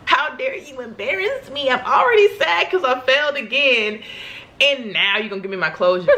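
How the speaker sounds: hammed-up offended grumble; mock outrage. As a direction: play up being wronged by the diver.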